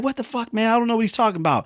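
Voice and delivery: high-pitched mock bewilderment; acted-out confused student; bewildered protest. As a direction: protest in a high voice, totally lost. Bewildered student reacting to being yelled at.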